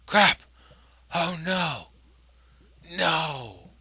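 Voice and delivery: sudden loud panicked crap; jolted yell of alarm; startled yell. Real, not acted. Gamer spotting lava below, startled yell.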